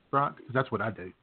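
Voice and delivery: ordinary delivery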